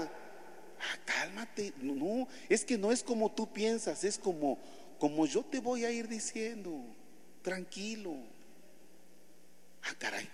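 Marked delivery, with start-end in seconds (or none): 0.0-8.1 soft, calming voice; calm an angry friend down gently; hushed reassurance to a hotheaded friend; soothing Jesus. Acted. Jesus calming Peter softly.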